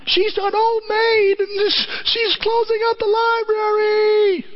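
high, slow, lamenting; mournful Clarence. Retold or imitated. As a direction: Clarence breaks sad news to George, high and slow. Clarence revealing Mary's fate.

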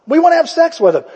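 high loud mocking shout; hammed-up mob yell. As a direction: yell the demand back.

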